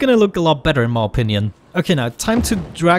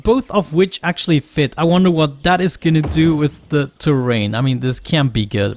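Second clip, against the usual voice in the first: ordinary delivery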